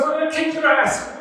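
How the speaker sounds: high-pitched heated threat; acted angry threat. Acted Balaam threatening donkey.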